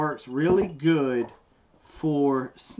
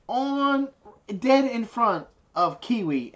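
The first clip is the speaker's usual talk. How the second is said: high, pitched up and louder, mock outrage; indignant; 9 semitones higher, 5 dB louder, slower than usual. Real, not acted. Telling the viewers his wife would ignore his beating, indignant. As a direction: protest it loudly, voice climbing high.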